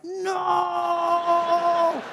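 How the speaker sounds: long, high, loud mock cry; horrified yell. Driver facing an oncoming car, long horrified yell. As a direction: stretch the word into a panicked shout. Car coming towards him.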